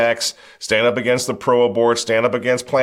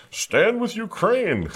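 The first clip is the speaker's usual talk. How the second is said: mocking slogan; 13 semitones higher, slower than usual. Sarcastic slogan with laughter.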